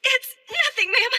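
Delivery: high, loud, rattled reply; flustered denial. Acted. Student assures the teacher after a scare, flustered denial. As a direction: cover up your alarm, voice jumping high.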